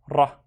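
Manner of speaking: "ra" short and separate; short syllable demo of "ra"; isolated syllable. Shown as a demonstration. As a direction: sound out "ra" on its own. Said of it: "I have three syllables".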